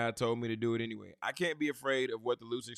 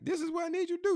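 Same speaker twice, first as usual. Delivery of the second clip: suddenly much higher, pressing the point; emphatic repeat; 3 dB louder, faster than usual. Real, not acted. Preacher to youth, repeating the command much higher. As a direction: hammer the command home up high.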